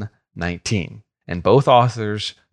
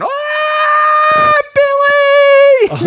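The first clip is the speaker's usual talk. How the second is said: shrieking, far higher and louder; siren-like scream; 7 dB louder, much slower than usual. Retold or imitated. Panicked mom racing across the playground, siren-like scream. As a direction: wail the name like a siren. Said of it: "like a siren".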